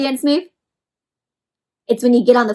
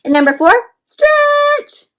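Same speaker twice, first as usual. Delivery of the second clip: stretched-out stretch; 5 dB louder, slower than usual. Shown as a demonstration. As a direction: stretch very high and slow. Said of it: "acting out worm stretch".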